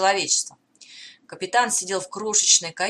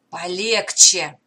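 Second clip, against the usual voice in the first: choked, rasping plea; hoarse wheeze; 6 dB louder, slower than usual. Acted. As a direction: wheeze it out with a grip on the throat. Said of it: "прохрипел Блейк".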